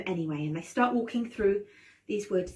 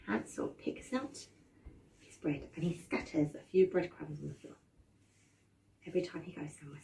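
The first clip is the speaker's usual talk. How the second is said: sneaky hush; 4 semitones lower, 7 dB quieter, faster than usual. A narrator's reading. Sneaky hushed narration.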